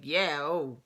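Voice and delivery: imitated Jersey Italian guy; Italian Jersey accent. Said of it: "he's so Italian".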